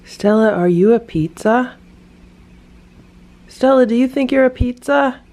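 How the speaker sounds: pet talk. Real, not acted. Teasing her cat.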